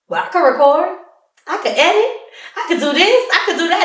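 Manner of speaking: imitating a keen volunteer; eager offers. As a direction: pile up each offer with an upward lift. Friend retold piling up eager offers.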